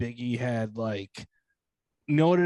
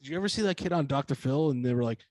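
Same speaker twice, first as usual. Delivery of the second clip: normal talking voice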